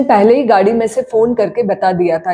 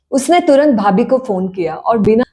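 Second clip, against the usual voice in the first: usual speaking voice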